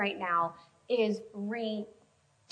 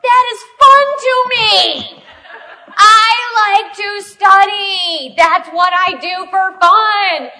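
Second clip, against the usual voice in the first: much higher, louder, slow half-sung protest; defensive outburst; 8 semitones higher, 15 dB louder, much slower than usual. Retold or imitated. Wife defending Bible study to husband, loud sing-song protest. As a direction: belt it out defending her hobby. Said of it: "I'm like".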